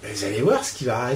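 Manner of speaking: usual speaking voice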